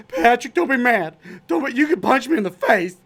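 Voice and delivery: high, loud, pleading; panicked bartender. Retold or imitated. Bartender frantically pleading with Patrick at his door. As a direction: beg him not to be mad. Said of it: "He was, he was".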